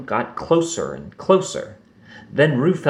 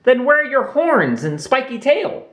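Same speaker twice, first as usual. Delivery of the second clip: put-on wondering young Roo; 13 semitones higher than usual. A narrator's reading. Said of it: "wondered Roo".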